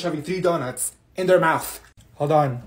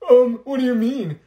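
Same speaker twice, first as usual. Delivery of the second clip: higher and louder, with attitude; sassy retort; 4 semitones higher, 6 dB louder than usual. Acted. Student snapping back at classmate, higher and louder. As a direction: throw the question back with attitude.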